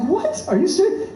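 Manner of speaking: high-pitched incredulous retort; pissed-off disbelief. Retold or imitated. Comic retelling his reaction to the ticket, indignant disbelief. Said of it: "This is where I get pissed".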